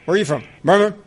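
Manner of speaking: usual speaking voice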